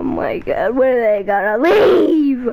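usual speaking voice

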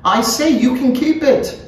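high, emphatic character voice; insistent repeat. A narrator's reading. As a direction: pitch it right up and insist.

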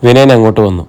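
ordinary delivery

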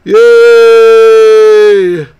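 loud high cheer; excited yay. Acted. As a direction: cheer for the team. Cheering after announcer call.

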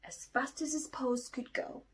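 ordinary delivery

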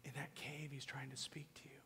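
hushed, intimate whisper. Real, not acted. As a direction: lean in and whisper it. Pastor whispers to the church.

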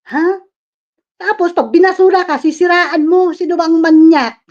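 loud, heated accusations; angry shouting. Real, not acted. Yelling at her ex that he is the pervert, furious. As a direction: shout him down as the real pervert.

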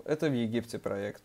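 ordinary talk

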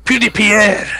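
mock-French rendering of the name; French accent. Shown as a demonstration. Co-host echoing the name as shown, French accent. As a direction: echo it in a put-on French accent. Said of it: "say it with the accent as well".

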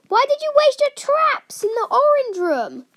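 high, loud complaint; exasperated shout. Real, not acted. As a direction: yell it in disbelief. Exasperated at teammate.

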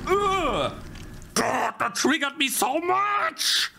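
high, strained furious shouting; real gamer rage meltdown; 1 rage screaming. Real, not acted. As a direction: lose it completely at the game. Raging after game death.

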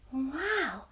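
a soft, amazed whisper; whispered wow. Real, not acted. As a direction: breathe the wow in awe. Reading facts, amazed.

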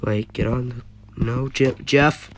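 normal talking voice

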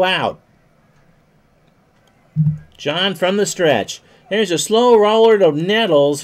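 baseball announcer calling the action; play-by-play. Acted. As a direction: call each pitch like live radio. Live play-by-play of baseball game.

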